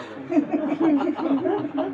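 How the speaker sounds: big audience laugh; crowd laughter. Real, not acted. Audience reacts.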